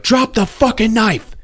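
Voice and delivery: high, slow order at gunpoint; commanding. Retold or imitated. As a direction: demand it, pitch way up. Man aims gun at killer.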